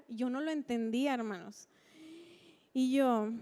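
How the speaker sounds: ordinary talk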